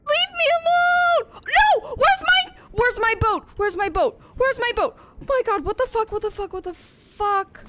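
high, loud frantic shouting; frantic high shouts at a monster; panicked yelling. Real, not acted. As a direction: yell at it in real panic. Creature warps player from boat.

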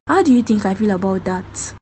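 normal talking voice

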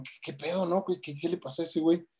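soft, high-pitched mock confusion; retold bewilderment. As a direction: imitate baffled onlookers asking under their breath. Stunned audience reacts to speaker fainting.

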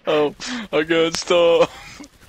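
high, slow excited exclamation; drawn-out high I got stuff. Real, not acted. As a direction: stretch it out thrilled. Excited find in game.

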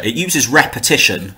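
ordinary delivery

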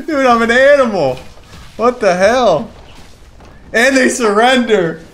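high, full-volume yelling with bursts of laughter; real ecstatic screaming. Real, not acted. Streamer realising he hit it and won, ecstatic screaming. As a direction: scream and laugh in sheer disbelief. Realises the winning play landed.

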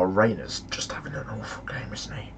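hushed whispered aside; real sly whisper. Real, not acted. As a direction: whisper it like a secret jab. Aside about bad player.

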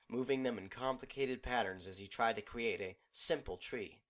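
usual speaking voice

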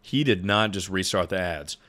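ordinary talk, nothing marked

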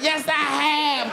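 high, drawn-out mock testimony; slow, high wail of agreement; impassioned crowd reply. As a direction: answer the singer like a devoted fan. Women in crowd answering singer.

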